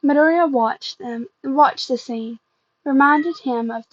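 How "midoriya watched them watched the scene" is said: usual speaking voice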